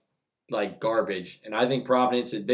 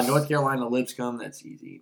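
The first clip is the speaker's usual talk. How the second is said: ordinary talk, nothing marked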